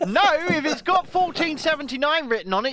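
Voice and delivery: high, loud outraged protest; indignant. Real, not acted. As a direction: insist hotly on the rule. Indignant about price law.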